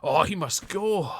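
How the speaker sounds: loud, high, slow Scottish heckle; booming Scottish accent heckling the Prime Minister. Pretend. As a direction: bellow it in a broad Scottish accent. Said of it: "was that a bit too Scottish for you".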